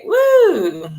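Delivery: high, drawn-out "woo"; delighted woo. Real, not acted. Gushing over her favourite [name] song, high delighted woo.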